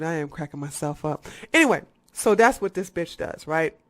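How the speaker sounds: ordinary delivery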